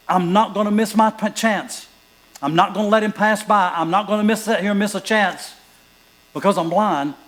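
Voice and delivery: loud, high, resolute beggar; determined blind man. Acted. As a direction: refuse to let the chance pass. Voicing Bartimaeus' resolve.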